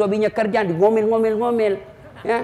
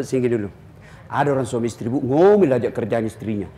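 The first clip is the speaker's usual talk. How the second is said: gentle low reply; 10 semitones lower than usual. Retold or imitated. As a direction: tell her gently so she's not offended. Ustadz answers wife gently.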